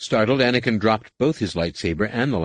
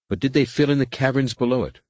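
excited, higher-pitched question; 7 semitones higher than usual. A narrator's reading. Anakin asking Rapung about the caverns below, excited. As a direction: press the question eagerly, pitched up. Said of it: "Anakin said excitedly".

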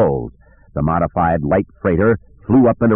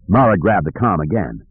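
ordinary delivery

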